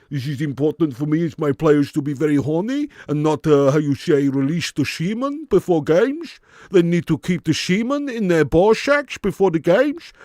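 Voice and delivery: loud, lecturing Dutch manager voice; hammed-up Dutch accent, halting then rising; Louis Van Gaal. Manager telling his players what matters, hammed-up Dutch accent. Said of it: "Can you do Van Gaal?".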